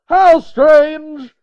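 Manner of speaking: very high, very loud character shriek; a piercing shout in a put-on voice; screamed back. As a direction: shriek it back at full force. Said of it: "he screamed back".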